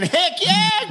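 very high, hammed-up squeal of joy; inner glee. Inner voice cheering at a job offer, very high mock squeal. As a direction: squeal it like an excited kid hiding it. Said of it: "but inside I was saying".

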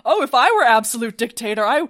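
loud, swooping mock self-righteousness; exaggerated, sing-song mock earnestness; mock naive boasting. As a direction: play up a would-be benevolent dictator, hammed-up. Repeats the mock would-be dictator.

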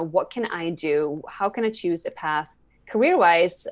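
ordinary talk, nothing marked

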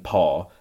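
"paw" with open aw, no R; Scottish pronunciation. Shown as a demonstration. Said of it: "the cat's got a sore paw".

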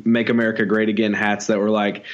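usual speaking voice

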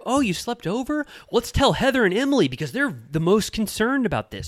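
high, bright mocking impression of a gossip; mock Tamra. Mocking Tamra telling Shannon about the sleepover, high and sly. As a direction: sing it out like a sly gossip stirring trouble.

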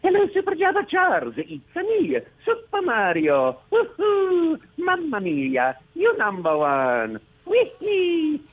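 high, booming sing-song cartoon plumber; Super Mario voice. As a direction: greet the kid as a bouncy Italian hero. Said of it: "Can you say a little something to Java Charles?".

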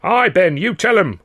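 loud, high cheering from the crowd; mob cheering. Acted. Dockers egging on their orator, loud cheer. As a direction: back your speaker up. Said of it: "went the murmuring mob".

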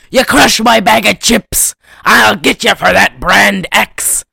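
hammed-up threat, loud; scary villain. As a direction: imitate a scary movie villain, punching every word. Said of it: "has this scary-ass voice".

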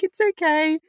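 a kind doctor comforting a crying patient; imitating soothing doctor. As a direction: reassure her gently.

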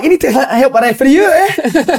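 high squeaky mock yell; mock shouting coach. Retelling the Falkirk guy yelling at the ref, high mocking shout. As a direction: ham up a ranting touchline guy. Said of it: "he was shouting non-stop the full game".

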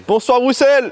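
high, loud shout to a concert crowd; stage greeting. Pretend. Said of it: "comme quand je monte sur scène".

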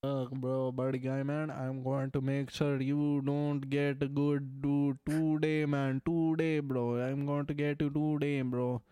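put-on Indian-accented playful threat. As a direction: Russell Peters style Indian accent. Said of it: "the Indian comes out".

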